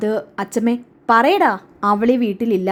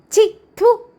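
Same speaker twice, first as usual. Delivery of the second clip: a sharp higher spit of disgust; contemptuous spitting; 10 semitones higher, much slower than usual. Acted. Grandmother scorns her daughter-in-law with a high spitting sound.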